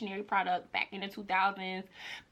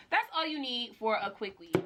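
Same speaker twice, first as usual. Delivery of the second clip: normal talking voice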